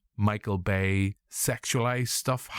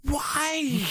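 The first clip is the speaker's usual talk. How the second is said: much higher, anguished cry of "Why?"; Terry impression. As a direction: wail it like an exasperated big guy. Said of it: "I feel like Terry in Brooklyn Nine-Nine".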